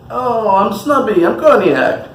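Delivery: a playful, overdone fake French accent; terrible snobby French accent. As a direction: ham up a snobby French cognac in a bad French accent. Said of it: "Terrible accent".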